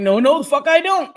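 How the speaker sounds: emphatic refusal. Retold or imitated. Mother telling son no about a hard drive, emphatic refusal. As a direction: refuse loudly, half in mock outrage.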